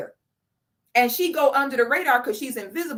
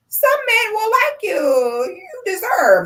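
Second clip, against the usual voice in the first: high, loud, slow tearful wail; mock pleading commenter; 11 semitones higher, 5 dB louder, slower than usual. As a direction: ham up a weepy cry of support. Mocking supportive commenters.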